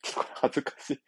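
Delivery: quiet giggly embarrassed mutter; embarrassed laughing aside. Real, not acted.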